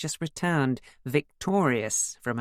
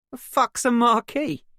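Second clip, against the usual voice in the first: giggling; 10 semitones higher, 7 dB louder than usual. Acted. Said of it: "Ethan giggled".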